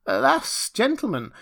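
high, loud plea in dismay; dismayed cry. A narrator's reading. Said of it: "somewhat dismayed".

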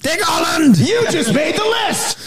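high hammed-up shout; mock shout. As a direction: yell it like a crazed threat. Mock outburst at the detective.

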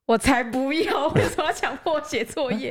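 mock whiny schoolkid; whiny kid. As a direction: sulk like a kid forced to write. Child refusing homework.

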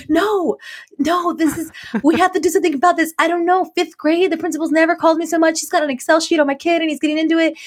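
high-pitched imitation of an upset mom; worked-up mom. Luca's mom insisting this is serious, worked up. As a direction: re-enact a mom's insistent complaint.

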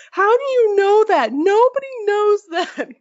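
high-pitched imitation of appalled disbelief; mock incredulous outburst. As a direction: mimic someone aghast, pitch way up. Retelling Zeke's appalled reaction to her.

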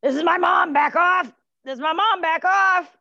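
high, loud shouted warning; shouty guard-dog voice; protective barking dog. Acted. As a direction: bark the words to guard Mom. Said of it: "Or it might be".

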